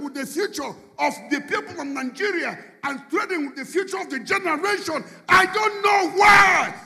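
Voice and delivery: high, intense preaching; preaching at full force. Acted. Preacher denouncing leaders trading with Nigeria's future, fiery. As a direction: build to a fiery climax.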